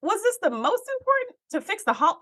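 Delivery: high incredulous question; high, baffled disbelief. Retold or imitated. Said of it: "I was just kinda like".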